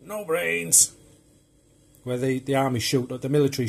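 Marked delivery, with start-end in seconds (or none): 0.0-0.9 higher, put-on movie zombie voice; playful high-pitched severed-head impression; talking zombie head. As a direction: quote the head in a raised mock voice. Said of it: "you see the head".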